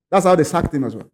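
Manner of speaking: ordinary talk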